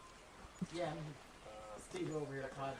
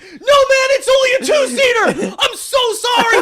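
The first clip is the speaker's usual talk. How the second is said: high, loud shouted apology through laughter; hammed-up frantic player; mock panic; 9 dB louder than usual. Gamer retelling his frantic apology to teammates, high shouted. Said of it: "you go like".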